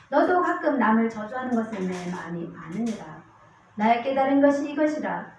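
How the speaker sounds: scripture reading. Acted. Preacher reading verses to the congregation, declamatory.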